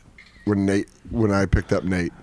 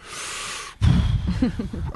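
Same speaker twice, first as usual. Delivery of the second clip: long breathy sigh, imitating defeat; drawn-out sigh of a beaten man; dejected sigh; 9 semitones higher, 5 dB quieter than usual. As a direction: let out a long, deflated mock sigh. Retelling comic's dejected answer after audition.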